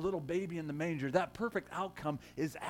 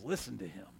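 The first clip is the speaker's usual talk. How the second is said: voice of God; 4 semitones lower, 5 dB quieter than usual. Retold or imitated. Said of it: "And it says".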